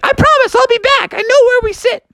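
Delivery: very high squeaky promise; high-pitched little frog shouting from afar; frog calling back. Acted. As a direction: holler back in falsetto. Froggy yells back to parents.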